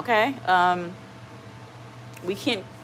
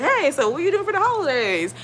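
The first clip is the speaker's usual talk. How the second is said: high, loud mock-cheery small talk; chatty therapist imitation; 9 semitones higher, 6 dB louder than usual. Client mimicking her nonstop-talking massage therapist, high and loud. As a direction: imitate an over-chatty talker, pitched way up.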